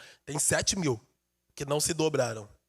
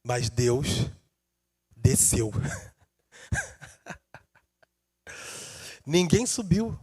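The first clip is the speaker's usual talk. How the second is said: soft intimate preaching; 8 semitones lower, 5 dB quieter than usual. Acted. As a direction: draw them in gently.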